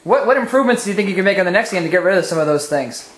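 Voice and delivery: ordinary delivery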